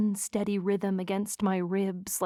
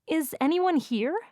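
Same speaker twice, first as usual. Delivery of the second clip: higher, questioning woman's voice; searching girlfriend; 8 semitones higher than usual. A narrator's reading. Girlfriend looking around the store, higher searching question. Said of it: "said with an air of looking around".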